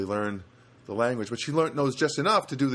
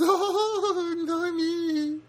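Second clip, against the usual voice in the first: very high, laughing mock Rosie; Rosie's broken English; much slower than usual. Imitating Rosie refusing her photo, laughing and squeaky. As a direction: giggle through a coy refusal in broken English.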